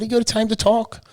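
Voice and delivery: high-pitched put-on voice for a question; Neil Hill. Judge stopping a competitor for a word, high impression. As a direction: ask in a hammed-up high impression. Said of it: "That's my Neil Hill impression".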